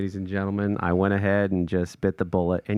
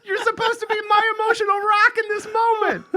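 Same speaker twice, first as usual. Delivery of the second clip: higher, laughing through the words; laughing plea. Real, not acted. Husband laughing as he repeats his plea to his crying wife. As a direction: plead while cracking up.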